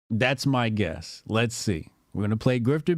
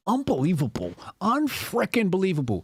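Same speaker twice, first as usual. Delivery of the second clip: mock echo rising higher on the second phrase; mocking imitation; 6 semitones higher than usual. Streamer repeating the politician's phrase to ridicule it, mockingly. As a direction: parrot the stilted phrase in ridicule. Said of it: "nobody that talks like that".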